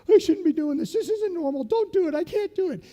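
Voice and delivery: high, loud frantic self-talk; panicked inner voice. Retold or imitated. Scared junior jumper talking himself out of it. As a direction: panic in your head.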